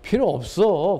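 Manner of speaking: ordinary talk, nothing marked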